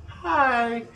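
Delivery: high-pitched child imitation; little kid. Replaying the child from the recording, little kid voice. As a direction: pitch the greeting up like a small child. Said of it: "little kid going".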